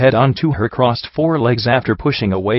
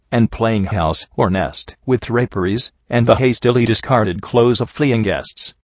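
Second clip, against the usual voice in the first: usual speaking voice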